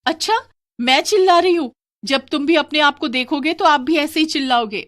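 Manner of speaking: high, loud retort; indignant wife. Acted. Wife answers her husband about screaming, high indignant retort. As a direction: throw it back at him, pitched right up.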